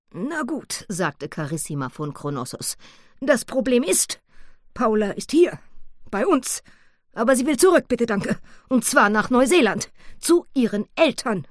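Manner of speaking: high, loud and emphatic; Carissima's voice. Acted. Carissima explains the problem. (Carissima, hoch und nachdrücklich)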